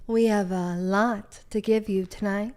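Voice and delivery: slow, drawn-out host patter; mock podcast host teasing tonight's show; best podcast voice. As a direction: stretch it out like a put-on host. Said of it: "Give me your best podcast voice".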